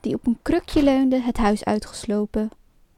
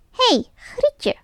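much higher witch call; shrill call for Grietje like a witch; 12 semitones higher than usual. A narrator's reading. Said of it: "riep ze".

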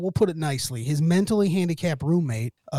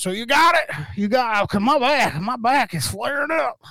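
mock Southern old-timer, pitched up; Southern twang; 7 semitones higher than usual. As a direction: complain in a put-on Southern twang. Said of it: "you got a little twang".

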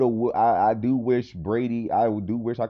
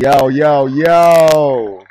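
loud hyped-up yo call; hype shout; 5 semitones higher, 16 dB louder, much slower than usual. Acted. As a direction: shout it to the crowd. Hype podcast intro shout.

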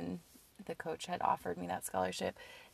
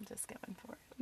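breathy whisper under the breath; whispered aside; 12 dB quieter than usual. Real, not acted. Host whispering encouragement to co-host, hushed.

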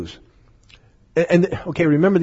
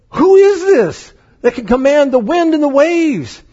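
high, louder astonished question; awed and freaked out; 9 semitones higher, 4 dB louder than usual. Retold or imitated. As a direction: gasp it out in awe, pitched up. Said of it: "they freaked out".

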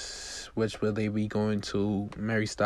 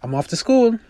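high, bright schoolgirl voice lifted well above the narrator; cheerful teen heading out the door; teen girl Claire; 13 semitones higher, 12 dB louder than usual. Acted. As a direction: daughter calling out on her way to school, much higher. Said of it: "Claire says".

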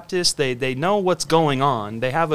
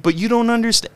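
higher, pushy lecturing tone; mock Pharisee; 6 semitones higher than usual. Himself as a Pharisee lecturing a believer, higher pushy voice. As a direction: play your own know-it-all self, pitch up. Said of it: "that's me being a Pharisee".

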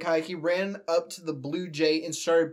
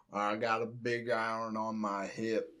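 very low Western cowboy voice, softer; Western accent; 7 semitones lower, 6 dB quieter than usual. Acted. Riffing on the Western cowboy line, in a low Western accent. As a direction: drop deep into a Western gunslinger's accent. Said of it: "in a Western accent".

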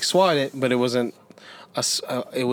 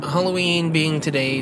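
ordinary talk

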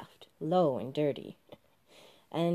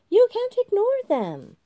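much higher, louder indignant cry; January protesting; 9 dB louder than usual. Acted. January indignantly insists on the minutes, much higher and louder. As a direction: protest shrilly.

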